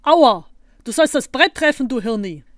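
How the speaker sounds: loud, high yelp turning into scolding; indignant yell after a knock; pained cry. A narrator's reading. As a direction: cry out in pain, then tell them off. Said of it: "schrie auf".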